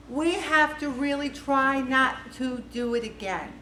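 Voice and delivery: usual speaking voice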